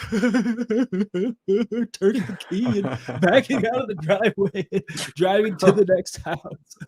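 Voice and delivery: high voice broken by fake sobs; mock sobbing. Young salesman driving to the next house, mock sobbing. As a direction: talk through hammed-up crying, half laughing. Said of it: "the tears are just intensifying".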